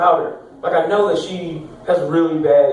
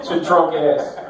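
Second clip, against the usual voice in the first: ordinary talk, nothing marked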